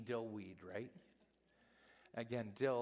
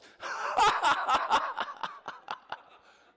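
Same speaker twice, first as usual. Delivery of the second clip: big, high, loud laughter; 16 dB louder than usual. Real, not acted. Pastor laughs after the quote.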